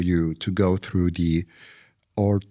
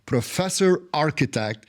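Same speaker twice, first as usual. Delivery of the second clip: formal introduction; 5 semitones higher than usual. Acted. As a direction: present the guest like a gala host. Host reads formal guest introduction.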